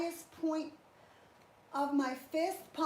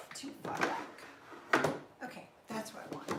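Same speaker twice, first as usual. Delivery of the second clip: whispered mutter; 4 semitones lower, 4 dB quieter, faster than usual. Real, not acted. Muttering to herself adjusting bench.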